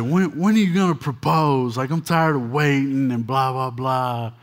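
whiny impatient fiancée demanding a proposal; nagging wife. Retold or imitated. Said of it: "She was like".

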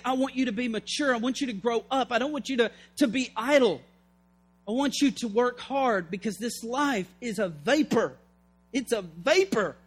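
ordinary talk, nothing marked

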